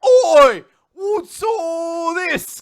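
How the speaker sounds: high, very loud slow shout; booming hero shout. Acted. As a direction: announce your arrival with a roar. [name] bursts in shouting.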